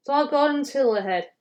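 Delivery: ordinary delivery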